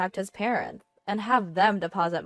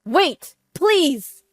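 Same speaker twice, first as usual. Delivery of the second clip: loud, high-pitched begging; frantic husband calling out; desperate cry; 9 semitones higher, 10 dB louder than usual. A narrator's reading. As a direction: cry out before she hangs up. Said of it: "my husband cried out in desperation".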